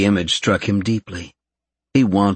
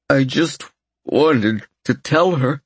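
trailing off; 6 semitones higher, slower than usual. Acted. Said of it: "he trailed off".

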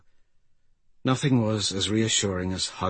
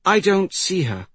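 high, loud, worried voice; anxious young girl; 10 semitones higher, 5 dB louder than usual. Acted. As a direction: say it urgently as the puzzled girl. Anxious girl searching for child.

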